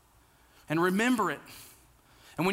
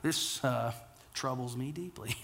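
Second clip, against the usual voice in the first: overwhelmed sigh; 4 semitones lower, 4 dB quieter than usual. Retold or imitated. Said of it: "it's just like".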